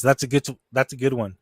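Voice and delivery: ordinary delivery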